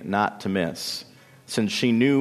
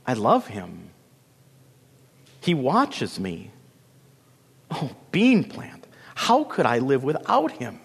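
lovesick Lily, pleading. A narrator's reading. Lily begs Bean Plant to understand her love, plaintive. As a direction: plead tenderly with aching pauses.

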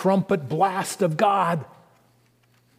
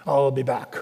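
mock Austrian accent, lower and heavy; Terminator Austrian accent; 4 semitones lower than usual. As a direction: ham up a lower Austrian action-hero accent. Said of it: "my Austrian accent's not so good".

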